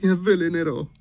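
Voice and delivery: high, loud tearful wail; tearful young lady. A narrator's reading. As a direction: cry it out in a high girlish voice. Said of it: "piangeva la signorina".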